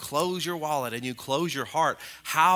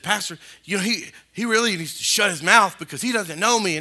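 re-enacted high, loud complaint; imitating his own worked-up griping; 6 semitones higher, 6 dB louder than usual. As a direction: gripe indignantly to your pastor, voice raised. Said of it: "I remember just griping to my pastor".

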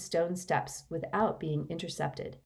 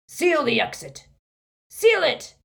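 higher, loud urgent command; shouted order; 10 semitones higher, 8 dB louder than usual. A narrator's reading. Yaxley ordering the exits sealed, shouting. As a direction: bark the order as you run.

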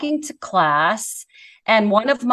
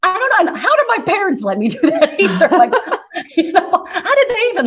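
laughing; 8 semitones higher, 3 dB louder, faster than usual. Real, not acted. Laughs at her own boldness.

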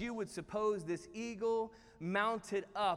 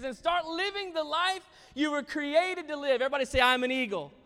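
raised, loud, forceful preaching; rally the whole room; rousing exhortation; 5 semitones higher, 7 dB louder than usual. Real, not acted. Preacher urges congregation to live as created, rousingly.

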